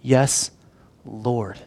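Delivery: soft, low, reverent assent; hushed submission; unhesitating answer. Acted. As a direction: answer simply and quietly, with a pause before the title. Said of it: "without hesitation".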